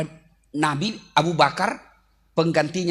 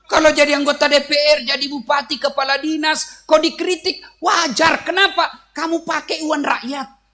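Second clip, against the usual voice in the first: fiery preaching; 8 semitones higher, 4 dB louder than usual. Real, not acted. Preacher lectures officials.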